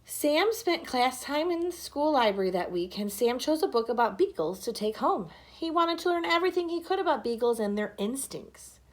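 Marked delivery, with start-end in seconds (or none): none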